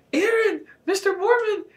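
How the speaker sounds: frantic high-pitched wail; breathless cry. A narrator's reading. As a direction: squeal it in panic, far above your own pitch. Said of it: "I cried breathlessly".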